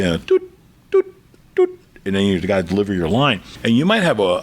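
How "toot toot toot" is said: three short, evenly spaced toots; clipped beep sounds with gaps between; imitated beeps. Shown as a demonstration. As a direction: mimic studio countdown beeps. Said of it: "three beeps and a tone".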